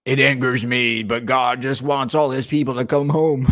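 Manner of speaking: mock the preacher's pious words; imitating preacher. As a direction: lightly mimic a pious preacher. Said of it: "imitating the preacher".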